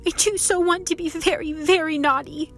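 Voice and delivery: put-on high, insistent child's plaint; lightly acted tearful insistence; bitter sobbing. A narrator's reading. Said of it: "more and more bitterly".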